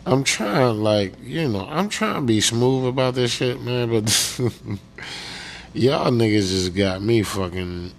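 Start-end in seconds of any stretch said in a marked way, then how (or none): none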